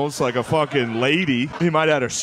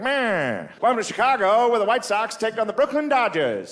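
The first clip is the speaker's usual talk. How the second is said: high, nasal-free vintage baseball call; old baseball radio call; old-time radio announcer; 8 semitones higher than usual. Retold or imitated. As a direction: crow it like a 1940s radio booth. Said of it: "You can hear the announcers".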